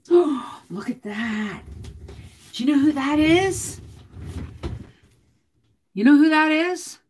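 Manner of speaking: high, delighted gasp at a photo; thrilled to show the viewers; excited. Real, not acted. Showing viewers an old family photo, excited and high.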